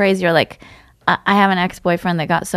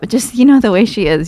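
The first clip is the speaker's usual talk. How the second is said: ordinary talk, nothing marked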